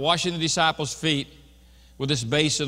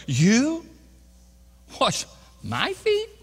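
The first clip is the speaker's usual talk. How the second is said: "You" high and loud, then a slow, rising "wash my feet"; disbelieving question leaning hard on "You"; emphatic YOU; 8 semitones higher, 3 dB louder, much slower than usual. Acted. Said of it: "this is an emphatic word".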